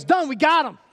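put-on older brother gloating in triumph; triumphant kid. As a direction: crow it like you just won. Kid gleeful mid-prayer.

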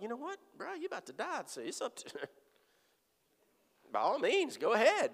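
high, mocking dare to a dying man; mocking sneer. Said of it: "I was like".

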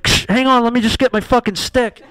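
high, loud, fed-up answer; radio back with mock exasperation; exasperated radio reply. Janitor answers the coyote call, exasperated.

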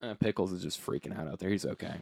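muttered low aside; quiet aside. Real, not acted. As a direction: say it under your breath. Aside about the cat.

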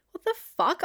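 high-pitched incredulous outburst; retold disbelief. Retelling her reply to his crying jibe, incredulous. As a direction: throw it out as a stunned retort.

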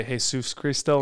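Jesus said hay-SOOS; Spanish pronunciation. Shown as a demonstration. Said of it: "Is that why we say".

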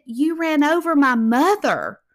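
loud, accusing, leaning hard on over; retold furious accusation; angry accusation. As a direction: shout the blame at her. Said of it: "And he said".

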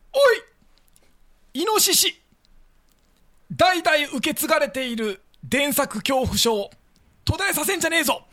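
high, loud raspy shout; hoarse voice. Shown as a demonstration. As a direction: bark it like a gruff old farmer. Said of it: "じゃあ、やってみますね". (しゃがれた大声で)

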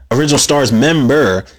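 ordinary delivery

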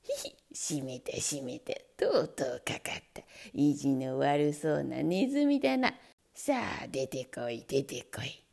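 low, gloating cackling voice; gleeful man. Acted. Red-faced man gloating at the trapped mouse. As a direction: gloat low over the catch. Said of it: "小躍りして言いました".

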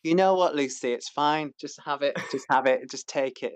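hammed-up Manchester accent, starting loud; Patrick, bad Manc accent. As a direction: have a clumsy go at a Manc accent. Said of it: "Should we do a Patrick impression?".

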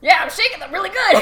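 fast, loud, breaking into laughter; laughing mock robber. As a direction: ham up the villain while laughing.

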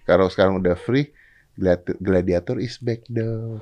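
slow, drawn-out teasing tone; teasing question. Retold or imitated. Imitating Juju's teasing question to a newly single host. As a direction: stretch out gladiator is back. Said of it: "Itu intonasinya dia tuh kalau nanya tuh".

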